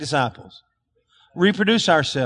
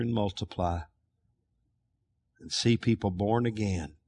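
lower, softer prayer voice; closing prayer; 6 semitones lower, 5 dB quieter than usual. Acted. Pastor leading the church in prayer, soft and earnest. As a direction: pray it quietly and earnestly.

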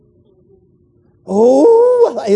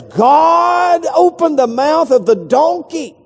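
shouted, drawn out; 9 semitones higher, slower than usual. Acted. Preacher to congregation on the talking donkey, slow shout. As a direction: ring it out to the back row. Preacher building to Balaam story.

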